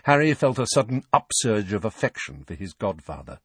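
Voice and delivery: ordinary delivery, nothing marked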